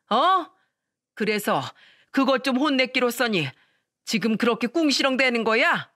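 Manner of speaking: a father-in-law roaring at her cheek; furious father-in-law. Retold or imitated. Father-in-law storms back out, shouting at the daughter-in-law. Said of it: "더 화를 내길 시작하셨습니다".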